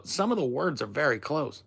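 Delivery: ordinary delivery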